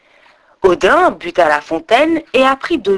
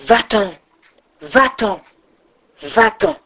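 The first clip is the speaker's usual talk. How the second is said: lightly voiced chorus, one command repeated; chorus of voices. A narrator's reading. Group of voices driving Odin away, choral. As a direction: chant the dismissal, spaced apart. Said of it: "Tout un groupe de voix reprit en chœur".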